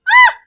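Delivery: short, very high, loud yelp; startled shriek. Acted. April is sent flying, startled shriek.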